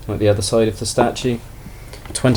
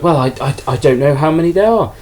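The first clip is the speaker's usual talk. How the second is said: flustered; 5 semitones higher than usual. Retold or imitated. Flustered seller put on the spot.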